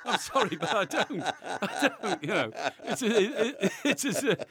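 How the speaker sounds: laughing. Real, not acted.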